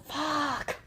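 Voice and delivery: high-pitched mock yell, held back in volume; mock muted yell. Wife teasing husband about his garage outbursts, mock muted yell. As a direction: imitate yelling without really letting it out. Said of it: "You go and yell".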